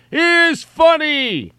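loud fake laugh into a high drawn-out cry; guffaw, then shriek the words slowly; mock hysterical laughter. Reader roaring at the imagined joke, hammed-up hilarity.

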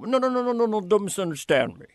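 backpedaling motorboat impression; mock flustered retreat from a question; Lorne. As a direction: burst into high runs of no's, then ease down. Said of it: "he would do this backpedaling motorboat sound".

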